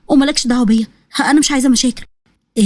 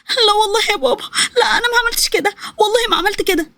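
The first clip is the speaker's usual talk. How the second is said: tearful pleading; 8 semitones higher than usual. Acted. The daughter begs her father while he beats her, high and tearful. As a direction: beg him to believe you through tears.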